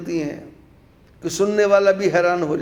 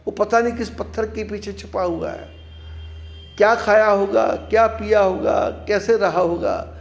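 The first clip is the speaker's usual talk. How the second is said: usual speaking voice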